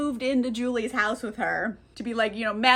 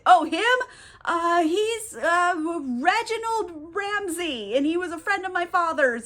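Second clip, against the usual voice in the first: higher, hesitant fake breeziness; acted cover story, pitched up and halting; improvising a lie; 6 semitones higher, slower than usual. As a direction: bluff through a made-up introduction.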